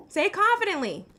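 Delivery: loud, high taunting challenge; taunting demand. Real, not acted. Taunting the troll on stream.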